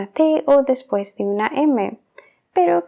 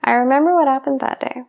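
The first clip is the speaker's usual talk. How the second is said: "I remember what happened that day" run together, "remember" as remember with the b swallowed; fast speech, b dropped; faster than usual. Shown as a demonstration. Teacher shows how the phrase sounds in fast speech. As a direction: rush it and drop the b. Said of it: "si estoy hablando muy rápido ... omito esa B".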